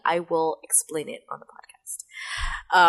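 whispered aside, slow. Acted. Whispering a promise to listeners on the podcast. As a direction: drop to a secret whisper.

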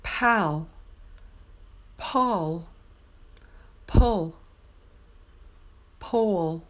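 Pal, Paul, pull, pole each vowel kept distinct and clear; contrasting vowels. Shown as a demonstration. Teacher modelling pal, Paul, pull, pole for practice. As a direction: model the four vowels one by one. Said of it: "I'm going to say each of these words in order two times".

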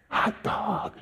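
high breathless whisper of delight; excited whisper. Retold or imitated. Whispering to himself after the first tract goes well, thrilled and hushed. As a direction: whisper it like you can't believe your luck.

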